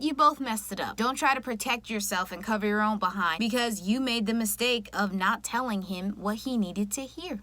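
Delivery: normal talking voice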